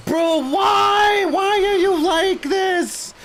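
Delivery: high, loud, slow exasperated yell; exasperated shout. Real, not acted. Gamer screaming at an escaping enemy, exasperated shout. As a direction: scream it out in disbelief. Boss keeps running, gamer explodes.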